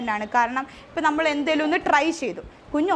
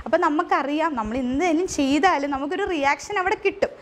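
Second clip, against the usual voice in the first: normal talking voice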